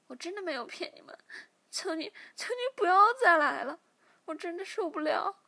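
high, loud desperate crying plea; begging for mercy. A narrator's reading. Captive girl after torture begging them to stop, crying high and loud. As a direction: beg frantically, voice breaking. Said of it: "小柔马上求饶起来".

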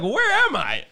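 high, loud self-mocking cry; exclaimed bewilderment. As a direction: blurt it out as if suddenly lost, hammed-up. Acting out own bewildered outburst.

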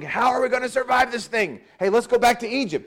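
high-pitched mock complaint; mock grumbling. Preacher imitating Israelites grumbling in the wilderness, mock complaint. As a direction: imitate a complaining crowd, voice pushed up. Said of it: "you were grumbling".

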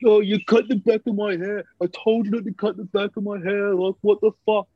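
mock the entitled twerp's whine; whiny angry customer. Hairdresser retelling the customer's complaint, whiny mock anger. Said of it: "He's like".

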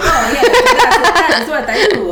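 loud, hammed-up Italian chef; mock an Italian accent, high and drawn out with laughter. Teasing her impression of a friend, exaggerated Italian chef accent. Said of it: "like, an Italian chef".

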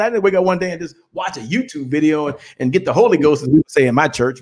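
normal talking voice

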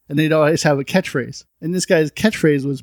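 ordinary talk

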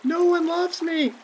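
high, slow mock-sad child. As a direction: whimper it like a lonely kid. Drowning child.

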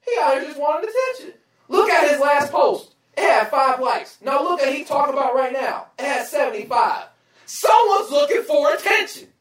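reading a thread, calling out an attention seeker; scoffing disbelief. Retold or imitated. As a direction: call out the fake, incredulous. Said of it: "I'm like".

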